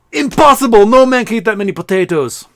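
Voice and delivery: high, very loud mock outburst; outraged Russian general. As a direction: explode in disbelief as a blustering Russian officer. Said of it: "Russian general's like".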